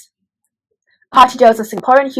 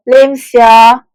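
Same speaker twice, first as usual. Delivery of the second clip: Singlish; 3 semitones higher, 6 dB louder than usual. Pretend. Singlish punchline.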